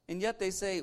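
usual speaking voice

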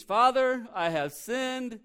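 a loud, slow, high rehearsed plea of "Father". Retold or imitated. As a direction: stretch out "I have sinned" like a memorized confession. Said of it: "he's reciting his speech".

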